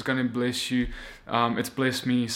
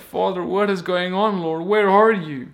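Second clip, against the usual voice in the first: lost believer; 4 semitones higher, 5 dB louder than usual. Retold or imitated. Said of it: "we're just like".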